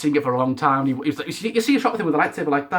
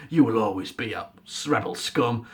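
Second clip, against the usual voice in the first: low, menacing mock Phasma sneer; lowered villain voice; 7 semitones lower, 5 dB quieter than usual. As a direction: growl it like a helmeted villain. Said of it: "she's like".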